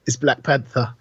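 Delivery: ordinary delivery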